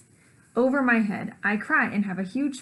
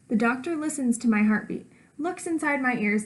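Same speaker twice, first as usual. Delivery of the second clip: ordinary delivery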